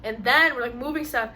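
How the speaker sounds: ordinary talk, nothing marked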